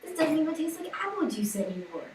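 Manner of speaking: retold complaint. Retold complaint in childhood juice story.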